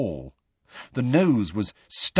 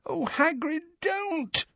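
much higher, softer fearful plea; moaned; 6 dB quieter, slower than usual. A narrator's reading. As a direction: plead softly in fright. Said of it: "moaned Hermione".

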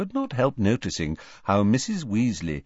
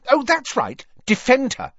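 higher, sharp sarcastic snap; snapping; 14 semitones higher, 4 dB louder than usual. Acted. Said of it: "snapped Ginny".

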